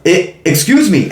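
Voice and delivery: mock his polite complaint; complaining neighbour. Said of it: "I'm not even gonna do the accent".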